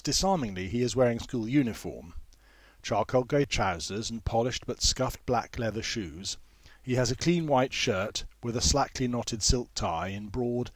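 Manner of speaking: ordinary delivery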